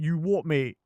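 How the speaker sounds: mock-aggressive London street voice; hammed-up roadman picking a fight; rough London accent. Mocking how tough English characters talk, hammed-up London accent. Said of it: "or they sound like".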